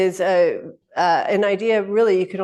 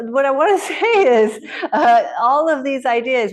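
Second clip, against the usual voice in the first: laughing a bit louder and higher; 6 semitones higher, 5 dB louder than usual. Real, not acted. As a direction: speak through a laugh.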